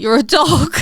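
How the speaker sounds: put-on bossy little girl at play; imitating a child giving orders in a game; playful child. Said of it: "she was like".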